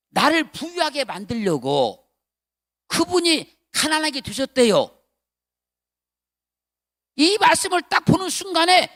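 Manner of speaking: loud, high preacher's cry; fervent preaching. Acted. Pastor retelling his revelation to church, loud fervent preaching. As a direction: shout the revelation with fervor.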